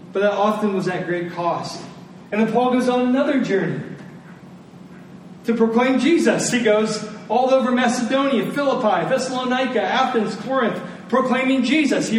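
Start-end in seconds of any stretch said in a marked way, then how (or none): none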